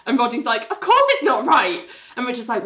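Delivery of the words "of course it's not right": high, mock-indignant witness; playfully acted indignation; indignant retort. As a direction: protest it, pitched up. Witness retorts in retold cross-examination.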